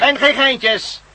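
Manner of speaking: menacing warning. Acted. Villain warning the captives at gunpoint, slow and menacing. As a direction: spell out the warning slowly.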